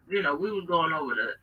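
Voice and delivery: ordinary delivery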